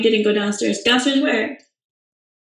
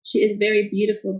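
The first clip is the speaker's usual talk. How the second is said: normal talking voice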